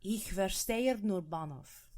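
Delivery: slow German phrase with a slightly Russian accent; accidental Russian accent. Real, not acted. Co-host repeats the phrase on request, Russian-tinged accent. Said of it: "That sounded Russian".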